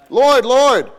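high, loud desperate "Lord, Lord"; pleading cry. Acted. Believers at judgment begging the Lord, loud pleading cry.

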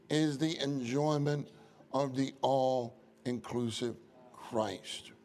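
ordinary talk

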